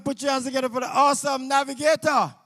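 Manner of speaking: loud, high hype-up to the crowd; booming emcee intro calling for applause; stage MC announcement. Acted. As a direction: announce the act like a showman. MC introduces the act.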